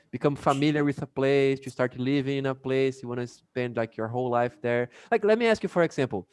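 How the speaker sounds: ordinary talk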